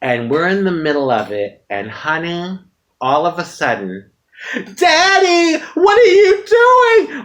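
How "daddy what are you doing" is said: put-on much higher, louder, slow little boy calling out; little boy catching his dad in the act; child's voice. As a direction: call out like a startled small kid. Child walks in on them.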